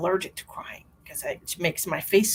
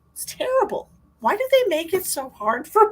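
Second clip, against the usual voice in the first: exasperated wail; 10 semitones higher, 5 dB louder than usual. Real, not acted. Exasperated complaint about crying.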